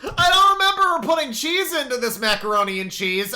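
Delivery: high, loud mocking imitation. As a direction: parrot her words back in a silly voice. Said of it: "mocking repeat of her line".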